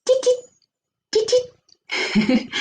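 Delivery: very high little "tit" squeaks; tiny high-pitched "chít" sounds; Vietnamese mouse squeak. Shown as a demonstration. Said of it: "in Vietnamese, they'll say".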